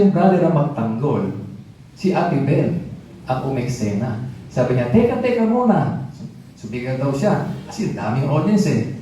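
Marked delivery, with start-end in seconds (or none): 4.9-6.1 high, insistent "wait, wait"; firm interruption. Retold or imitated. Ate Bel halting the shouting minister, high and firm. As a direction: cut him off, raised and commanding.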